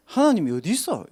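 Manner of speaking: loud, high angry demand; angry old man. Retold or imitated. Old man shouting at the evangelist, loud and angry. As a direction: bark it back at the young man. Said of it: "화를 내시는 거야".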